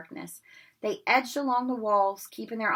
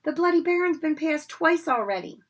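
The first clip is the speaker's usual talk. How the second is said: high, loud and scared; frightened Neville; 9 semitones higher, 6 dB louder than usual. Acted. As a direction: panicky pleading. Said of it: "scrambling to his feet".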